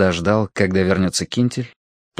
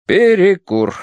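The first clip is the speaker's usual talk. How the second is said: announcing; 10 semitones higher, 5 dB louder, slower than usual. A narrator's reading. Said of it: "объявил Корнеич".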